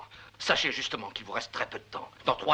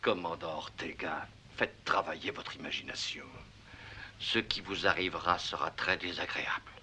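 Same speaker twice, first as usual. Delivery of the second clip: menacing threat; 6 semitones lower, 5 dB quieter than usual. Acted. Magistrate threatens the commandant.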